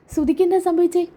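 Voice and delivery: high-pitched startled question; acted alarm. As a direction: ask in feigned shock. Friend shocked by accident news.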